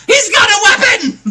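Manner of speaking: high-pitched joking panic; mock alarm. Joking about an axe being drawn, mock alarm. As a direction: cry out in pretend fright.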